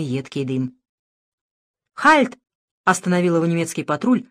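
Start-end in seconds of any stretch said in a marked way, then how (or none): 1.9-2.4 sharp barked halt; резкий окрик. Acted. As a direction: bark it like a soldier. Немецкий патруль останавливает. (резкий солдатский окрик)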